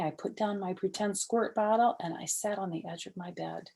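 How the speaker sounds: ordinary delivery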